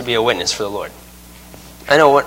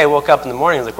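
ordinary talk

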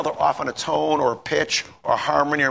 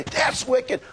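cheesy smile; 9 semitones higher than usual. Retold or imitated. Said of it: "I had a big smile on my face, cheesy smile".

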